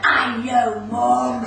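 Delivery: loud, drawn-out mock shout; exasperated child. Little girl answering her mum about the pop star, exasperated. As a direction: imitate a kid loudly fed up with mum.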